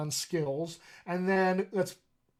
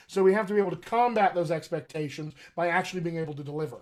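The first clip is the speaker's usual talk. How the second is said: ordinary delivery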